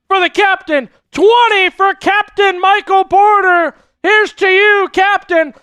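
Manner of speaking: rally the chat for the captain; excited cheer. Real, not acted. Cheering a donor on stream.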